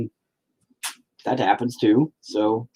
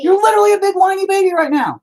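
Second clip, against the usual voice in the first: high mocking whine; 5 dB louder, faster than usual. Real, not acted. Dad teasing his son back, high mocking whine. Playful bickering with child.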